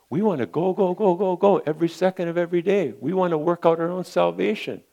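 mimicking our restless hurry. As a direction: rattle it off like someone who can't sit still. Mocking restless busy flesh.

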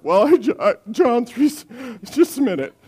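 high, broken, hammed-up crying; mock tearful testimony. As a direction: imitate someone too choked up to get words out. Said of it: "she cries".

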